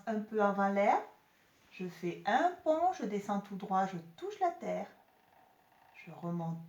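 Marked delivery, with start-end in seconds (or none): none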